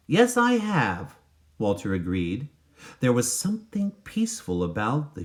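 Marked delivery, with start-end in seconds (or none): 0.1-1.2 put-on lightly higher, slow boyish reply; barely voiced boy agreeing; a hint of a young boy. Walter answers the man, lightly voiced boyish.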